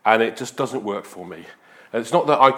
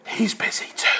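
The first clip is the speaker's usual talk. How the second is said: put-on small, breathy child whispering; child's whisper; 4 dB quieter than usual. Small boy whispers to salesman on phone. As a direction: whisper it like a scared little boy.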